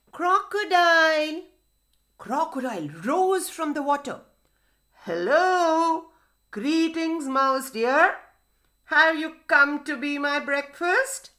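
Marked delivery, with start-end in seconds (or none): 0.1-1.4 calling out. A narrator's reading. Mouse deer summoning crocodile from the river, calling out. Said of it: "He called out". 5.1-11.3 lightly voiced storybook crocodile, pitched up; crocodile character. Crocodile greeting mouse deer as breakfast, lightly voiced.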